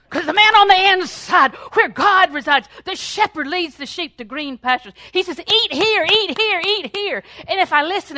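high, loud fervent preaching; loud revival preaching; full-force preaching. Acted. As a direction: preach it out with fire.

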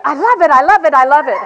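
imitating a husband faking delight; fake enthusiasm. Husband humoring his wife, hammed-up mock rave. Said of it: "he didn't mean it".

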